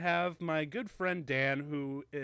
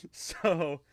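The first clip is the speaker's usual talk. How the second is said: ordinary talk, nothing marked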